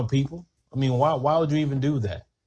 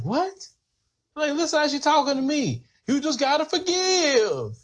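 high mock preachy voice; 11 semitones higher than usual. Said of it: "some of 'em that be out here".

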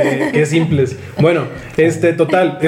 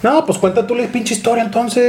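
higher, huffy fake offense; mock annoyed; 7 semitones higher than usual. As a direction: play at being fed up with the interruptions. Fastidiado porque lo interrumpen. (fastidio fingido, más agudo)

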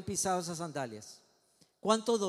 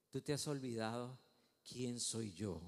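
low, hushed divine voice; God's quiet rebuke; 7 semitones lower, 8 dB quieter than usual. Acted. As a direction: speak softly and low, with grave authority. God voiced answering Moses.

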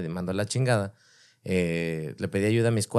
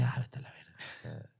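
whispered aside; 4 semitones lower, 13 dB quieter than usual. Retold or imitated. Retold tearful reply to daughters.

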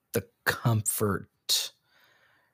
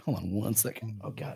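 moving away from the mic; fading out; 8 dB quieter than usual. Real, not acted. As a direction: voice drifting off-mic. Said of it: "I'm walking away from the microphone".

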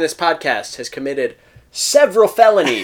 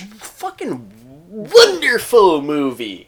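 gushing praise; 7 semitones higher, 4 dB louder, much slower than usual. Real, not acted. Gushing over the movie.

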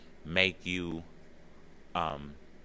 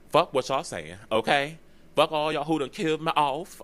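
defiant outburst; 8 semitones higher, 5 dB louder than usual. Retold or imitated. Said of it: "And it's like".

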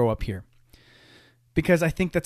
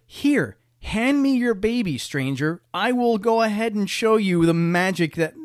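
high-pitched, grandly overconfident mockery; mock presumptuous stranger; 8 semitones higher, 4 dB louder than usual. Stranger demands the baby to show off, in a high, hammed-up voice. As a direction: pitch it way up and proclaim it.

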